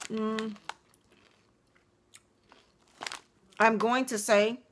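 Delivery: chewing while talking; muffled crunchy speech; mouth full of chips. Real, not acted. Seen 0: Chip put in mouth at -3; closed, chewing lips through 4.9.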